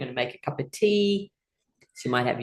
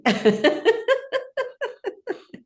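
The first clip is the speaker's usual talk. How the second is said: high, rolling laugh; long high-pitched laugh. Real, not acted. Recalls laughing on the bus.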